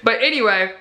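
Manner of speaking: ordinary talk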